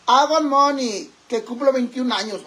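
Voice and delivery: mock birthday shout-out. Birthday greeting again.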